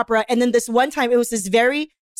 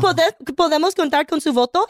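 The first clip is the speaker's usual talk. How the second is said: higher-pitched fresa Spanish; sassy phone banker with a fresa accent; 5 semitones higher than usual. Shown as a demonstration. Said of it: "you have, like, a little fresa".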